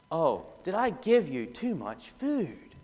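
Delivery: slow, soft and unsure; soft hesitant parent. Shown as a demonstration. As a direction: coax the child timidly. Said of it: "Compare that with".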